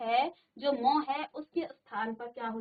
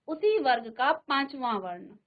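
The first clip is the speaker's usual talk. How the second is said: ordinary talk, nothing marked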